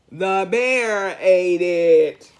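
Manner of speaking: slow, booming announcement; big reveal. A narrator's reading. Reader loudly revealing to children who took the sandwich. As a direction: boom out the shocking answer.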